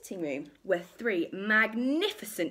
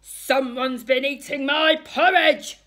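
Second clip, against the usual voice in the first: very loud, outraged bear roar; big raised-pitch growling shout; growling Daddy Bear; 6 semitones higher, 12 dB louder than usual. A narrator's reading. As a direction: bellow it like an angry father bear. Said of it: "growled Daddy Bear".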